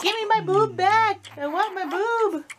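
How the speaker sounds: put-on high, slow, whiny baby voice; baby's voice. Mother voicing her hungry baby daughter in a high whine. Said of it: "He's like".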